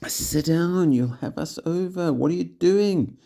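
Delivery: high, loud exasperated shout. A narrator's reading. Giles shouting at Kelly to sit down, high angry shout. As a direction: yell at her to sit still. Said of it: "He was shouting at Kelly".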